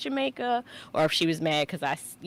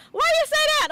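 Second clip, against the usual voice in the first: very high, louder mock-puzzled voice; imitated questioning nurse; 5 dB louder, much faster than usual. Patient retelling nurse's question at second shot, high mimicry.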